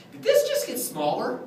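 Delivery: high-pitched puzzled question; mock astonishment. Clown looking at the wand before kids, mock astonished. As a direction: ask it high, as if truly baffled.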